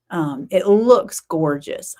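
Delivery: ordinary talk, nothing marked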